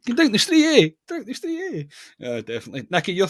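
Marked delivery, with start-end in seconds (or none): 0.1-0.9 laughing mock Edinburgh. Co-host parroting his friend's Edinburgh joke, laughing mimicry. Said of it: "That's an Edinburgh Uri accent". 1.1-2.3 mock Edinburgh accent. Co-host teasing his friend with a mock Edinburgh accent. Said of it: "That's an Edinburgh Uri accent".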